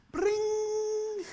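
high, sustained sung ring; ringing bling sound. Retold or imitated. Man admiring a ring with partner, high ringing imitation. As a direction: imitate a sparkling chime, held long.

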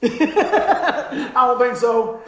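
drawn-out laughing reply. Retold or imitated. To the man predicting doom, slow and laughing. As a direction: stretch it slowly through a laugh.

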